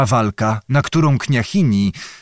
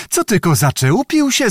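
indignant call; 8 semitones higher than usual. A narrator's reading. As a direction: voice leaping high on the question. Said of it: "zawołała kniachini". (podniesiony, oburzony okrzyk kniahini)

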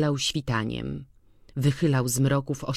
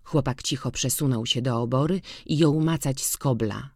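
plain reading voice

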